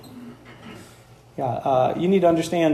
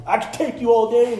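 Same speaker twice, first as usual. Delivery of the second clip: put-on boastful kid; 5 semitones higher than usual. Son trash-talking Michael Jordan.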